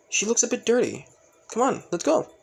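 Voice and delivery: high-pitched, lively character voice; bustling friend. Acted. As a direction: pipe up high and hurry someone along. Voices Sakura bustling Toga along.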